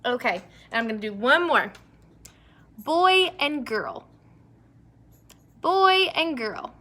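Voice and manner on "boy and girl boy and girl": slow, higher teacher read; slow rhyme demo. Shown as a demonstration. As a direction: lay out the word pair for the kids. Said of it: "Nope, good job".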